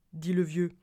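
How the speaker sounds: usual speaking voice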